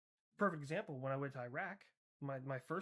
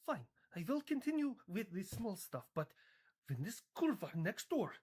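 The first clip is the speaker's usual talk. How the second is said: higher-pitched mock Polish accent; 4 semitones higher than usual. Poland settles for the small stuff, in a mock Polish accent. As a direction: grudgingly give in, with a put-on Polish accent. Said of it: "Ah, Poland".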